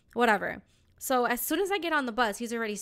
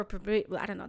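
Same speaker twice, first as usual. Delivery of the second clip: usual speaking voice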